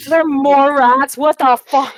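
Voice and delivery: loud, slow, incredulous exclamation; real loud dismay, drawn out; shocked outburst. Real, not acted. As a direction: blurt it out loud in disbelief. Player reacts to another rat wave.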